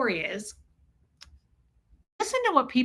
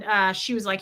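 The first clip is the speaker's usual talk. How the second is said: ordinary talk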